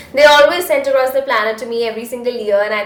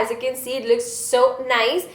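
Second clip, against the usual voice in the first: usual speaking voice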